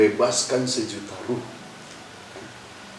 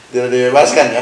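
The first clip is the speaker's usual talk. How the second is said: loud, through real laughter; amused, laughter-loud question; 3 semitones higher, 11 dB louder than usual. Real, not acted. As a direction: ask it while still laughing. Laughing along with the joke.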